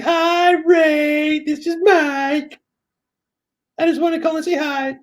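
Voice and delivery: very high, drawn-out falsetto voicemail; mock falsetto, stretched and singsong; high-pitched girlfriend imitation. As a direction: mimic her phone message way up high. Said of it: "in a really high-pitched voice".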